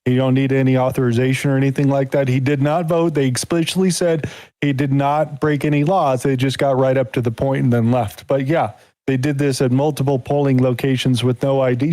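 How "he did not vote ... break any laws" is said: ordinary talk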